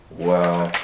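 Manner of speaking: usual speaking voice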